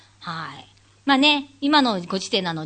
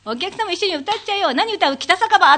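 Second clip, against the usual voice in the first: egging on crowd; 9 semitones higher, 4 dB louder than usual. Retold or imitated. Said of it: "とか言って".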